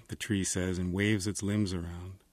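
ordinary delivery, nothing marked

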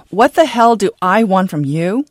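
loud furious yell at his daughter; father screaming at defiant daughter; screamed. Acted. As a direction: explode at her in rage. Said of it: "Then he screamed".

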